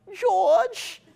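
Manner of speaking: high squealy cartoon piglet call; Peppa Pig impression. Shown as a demonstration. Speaker demonstrates Peppa Pig impression for audience, high squeal. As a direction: squeal it like Peppa calling her brother. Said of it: "give your best Peppa Pig impersonation".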